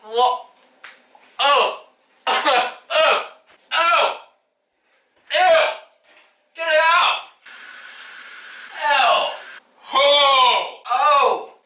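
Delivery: high pained gasps and yelps from spicy food; burning mouth reactions. Real, not acted. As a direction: yelp as the pepper burns. Eating raw pepper, reacting.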